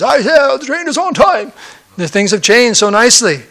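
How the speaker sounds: high-pitched mock British accent; hammed-up British exclamation; British man. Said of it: "The British man says".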